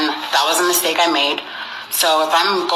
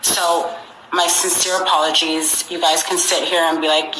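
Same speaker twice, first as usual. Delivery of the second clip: ordinary delivery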